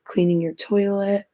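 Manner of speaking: ordinary delivery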